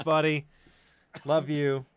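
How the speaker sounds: ordinary talk, nothing marked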